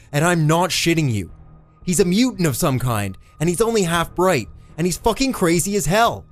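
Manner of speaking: high, emphatic frantic yelling; hollering. A narrator's reading. Eddie warns the cop about the killer son, hollering. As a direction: holler a desperate warning, punching the words. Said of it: "drew a deep breath and hollered".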